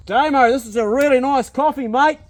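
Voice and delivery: delighted, high and loud; thrilled by the smell; excited. Real, not acted.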